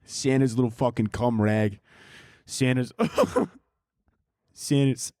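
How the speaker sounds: half-held Brooklyn Santa breaking into laughs; Brooklyn Santa Claus. Pretend. Comedian laughing at his own Santa joke, mock Brooklyn Santa. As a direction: crack up while playing Santa. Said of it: "is my impression of Santa Claus".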